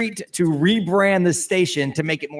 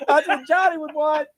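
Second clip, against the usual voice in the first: high-pitched parody voice; hammed-up mimicry; mock echo; 10 semitones higher than usual. Mimicking the line given to him.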